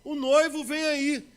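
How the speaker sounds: high, loud, slow cry; the herald crying out the bridegroom's arrival; shouted announcement. Acted. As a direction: call it out like a herald in the night. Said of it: "alguém e gritou".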